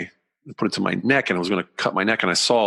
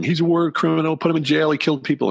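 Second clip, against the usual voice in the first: retold yelling; 9 semitones higher than usual. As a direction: replay her shrill accusations at a raised pitch. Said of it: "she's yelling".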